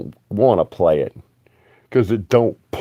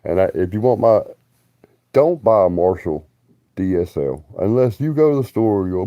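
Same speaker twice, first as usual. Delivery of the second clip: ordinary delivery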